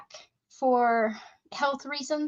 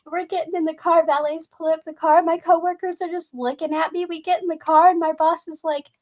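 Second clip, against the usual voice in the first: ordinary talk, nothing marked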